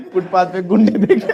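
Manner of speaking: high laughing disbelief. Real, not acted. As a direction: laugh at the student's answer. (हँसते हुए)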